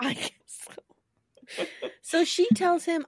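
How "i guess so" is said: slow whispered aside. Real, not acted. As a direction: breathe it slowly.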